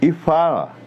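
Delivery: e fala said around a pen in the teeth. Shown as a demonstration. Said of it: "Prenda nos dentes".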